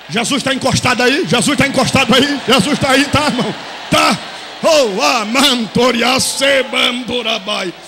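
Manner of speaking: fala forte e exaltada; pregação em brados. Acted. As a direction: inflama a igreja. Pregação e línguas. (pregador exaltado falando em línguas)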